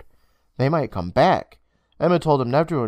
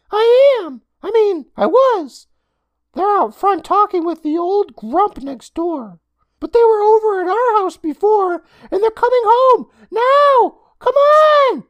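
put-on high, loud boyish voice, urgent; panicky boy; 9 dB louder, slower than usual. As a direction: hurry her out before they come. Frightened boy urges sister out.

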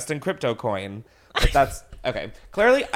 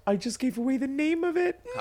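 dismayed cry; 12 semitones higher, 6 dB quieter than usual. Real, not acted. Realizes she gave away secret.

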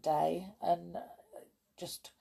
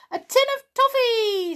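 much higher, louder squeal; 9 dB louder than usual. Acted. Perry opening his present, high delighted squeal. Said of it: "squealed Perry".

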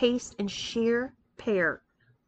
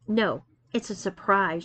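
ordinary delivery, nothing marked

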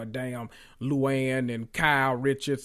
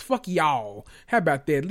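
ordinary talk, nothing marked

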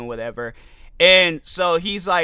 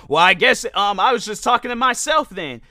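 mock yell; 6 semitones higher, 5 dB louder than usual. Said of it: "he yells out".

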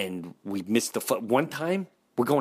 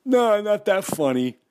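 high, loud teasing denial; mocking friend insisting he's not funny; scoffing Dee; 9 semitones higher, 6 dB louder than usual. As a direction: shoot him down with a laugh in the voice.

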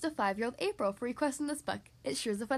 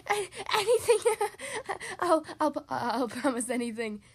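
breathless laughing plea; 4 dB louder than usual. Acted. Tickled dragon promising anything, laughing plea. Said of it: "pleaded the dragon".

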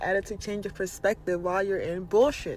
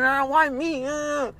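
"Ah, why me?" as a put-on whine, a bit higher and louder; mock complaining whine; 7 semitones higher, 4 dB louder, slower than usual. Mocking someone stuck eating ramen noodles, with a whiny voice. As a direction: mimic a self-pitying grumbler over cheap food. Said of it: "complaining and grunting and saying".

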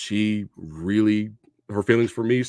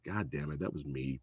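low, hushed words to himself; subdued, low retold thought; quiet realization; 7 semitones lower, 14 dB quieter than usual. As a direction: say it softly, as a private admission.

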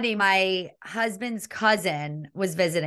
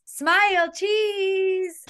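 high, drawn-out "cheese"; mock photo cheer; 10 semitones higher, much slower than usual. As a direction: exaggerate an American posing for a snapshot. Mimicking Americans posing for pictures.

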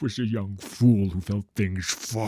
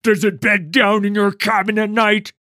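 high, shouted accusing question; loud, hostile grilling; angry interrogation; 12 semitones higher, 17 dB louder than usual. Acted. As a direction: bellow the question at a cornered man. Interrogator threatens farmer.

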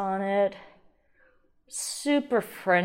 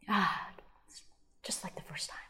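whispering; 11 dB quieter than usual. Real, not acted. Hushed after the sip.

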